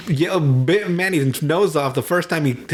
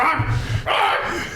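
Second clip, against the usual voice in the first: sharp high barking yells; a retold angry bark, pitched high; mock bark; 12 semitones higher than usual. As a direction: bark like a furious dog. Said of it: "He just fucking barks".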